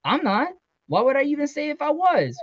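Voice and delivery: high-pitched indignant denial; high voice pleading innocence; defensive protest. A narrator's reading. Acted defensive denial in quarrel.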